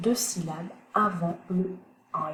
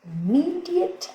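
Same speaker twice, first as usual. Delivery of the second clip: "immediate" said much higher and slowly, stress on "-me-"; high, slow stress demo; 11 semitones higher, slower than usual. Shown as a demonstration. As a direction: lift "immediate" high, leaning on "-me-". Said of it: "teacher models word stress". ("immediate" aigu et lent, accent sur "-me-")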